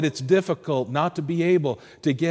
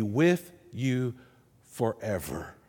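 slow "with you", then "forever" low and soft; stress on forever; 4 semitones lower, 6 dB quieter, slower than usual. Retold or imitated. Jesus promising his disciples a helper, slow weighty "forever". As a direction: pause before "forever" and let it sink deep. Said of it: "Jesus probably emphasized that word".

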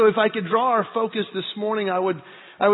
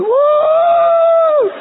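long, very high, loud woo; mock children's cheering scream; excited woo; 13 dB louder than usual. Boys waving napkins, long excited woo. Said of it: "they're standing there going".

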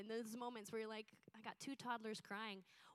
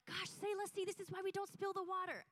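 high and loud scolding; exasperated mom snapping again; frustrated yell; 9 semitones higher, 4 dB louder than usual. Retold or imitated. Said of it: "in my frustration and fear".

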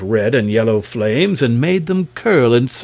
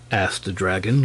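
usual speaking voice

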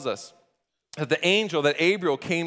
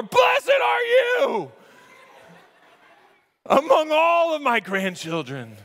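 grandma's shout; 11 semitones higher, 5 dB louder, slower than usual. Pretend. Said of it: "If you went to grandma's house".

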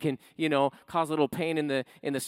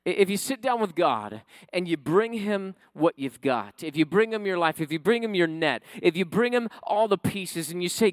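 ordinary delivery

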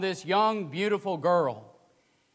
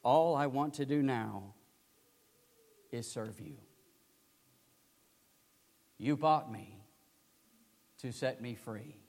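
tender devotion; 8 semitones lower, 7 dB quieter than usual. Retold or imitated. Kneeling girl pledges grateful service.

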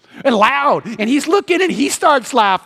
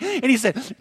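ordinary delivery

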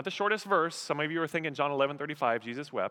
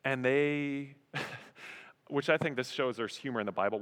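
normal talking voice

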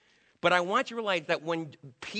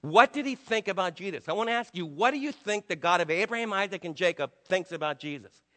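normal talking voice

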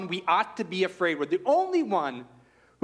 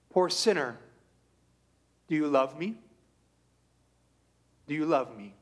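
voice of Christ; 4 semitones lower than usual. Acted. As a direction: ask tenderly, letting it fall away. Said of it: "this is what he's saying to me".

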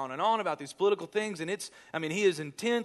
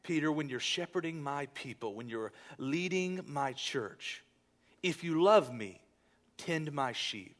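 preaching; 3 semitones higher, 5 dB louder than usual. Acted. Pastor to congregation, rising preaching retelling. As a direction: swell up and press the third question hard. Sermon retelling of Jesus and Peter.

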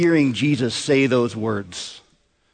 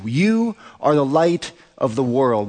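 slow, pointed stress on "You"; personal emphasis; slower than usual. Real, not acted. Pastor personalizes it for each listener, slow stress on "You". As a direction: aim a drawn-out "You" straight at one listener. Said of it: "We gotta personalize this".

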